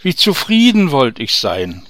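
a loud, longing outcry; calling out. Acted. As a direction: cry the wish aloud. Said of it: "rief der Oberst aus".

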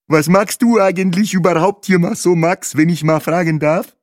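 high-pitched, loud, smacking character voice; munch noisily through a high, cheeky question; smacking while eating. A narrator's reading. Bahe quizzes a table companion while eating, smacking and high-pitched. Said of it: "schmatzte Bahe".

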